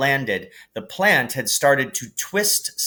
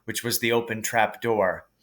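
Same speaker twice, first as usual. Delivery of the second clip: ordinary delivery, nothing marked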